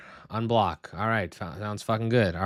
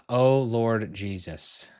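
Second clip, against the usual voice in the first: slow, dragged-out oh Lord Jesus; slow weary plea; much slower than usual. Real, not acted. Streamer exhausted by the setup, slow weary plea. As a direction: draw out each word, worn out. Exasperated, repeated slower.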